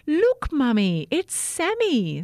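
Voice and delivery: high, loud delighted cry; excited child. Acted. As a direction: call to mum in delight. Child excitedly spots her lost toothbrush.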